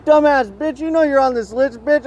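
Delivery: high-pitched mock yell at a rival; over-the-top taunting voice, pitched high; acted yelling. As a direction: ham it up like a trash-talking heckler.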